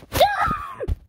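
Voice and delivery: high triumphant yell; victory shout. Real, not acted. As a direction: cheer the win. Winning round, cheering.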